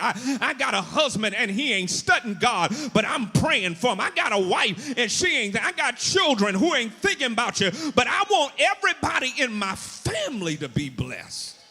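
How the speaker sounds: loud, driving sermon climax, pitch climbing; full-force preaching. Real, not acted. Preacher wanting the whole family blessed, impassioned and loud. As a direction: build to a ringing cry for the whole family.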